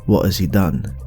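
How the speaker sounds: ordinary delivery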